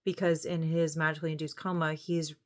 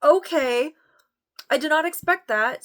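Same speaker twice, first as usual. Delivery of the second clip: high, loud startled exclamation; surprised reaction; 9 semitones higher, 7 dB louder than usual. Retold or imitated. Viewer reacting to a plot twist, loud and surprised. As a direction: gasp it out, caught off guard. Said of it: "and you're like".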